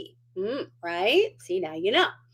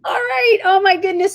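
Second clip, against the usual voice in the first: surprised cry; 11 semitones higher, 9 dB louder than usual. Real, not acted. [name] reappears on the call.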